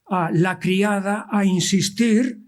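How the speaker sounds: usual speaking voice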